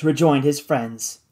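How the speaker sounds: usual speaking voice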